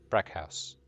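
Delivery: low, firm Brackhaus, brack-house. Shown as a demonstration. GM settles the envoy's name, low and firm. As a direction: state Brackhaus as the final answer. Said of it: "Brackhaus or Brachus?".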